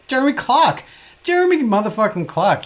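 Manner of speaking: loud, high hyped greeting; excited shout-out. Real, not acted. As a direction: hype up the viewer's name. Hyped greeting of a chat viewer.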